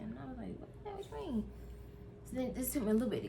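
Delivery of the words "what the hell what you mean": high, incredulous retold reaction; mock alarmed what-the-hell; startled. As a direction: jolt awake and demand an answer. Woken by son, startled reply.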